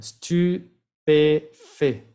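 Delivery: Stupéfait very slow, ending on an open è; slow demo. Shown as a demonstration. Teacher modelling Stupéfait slowly. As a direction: stretch Stupéfait syllable by syllable. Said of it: "como una E al final".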